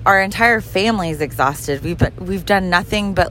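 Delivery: ordinary delivery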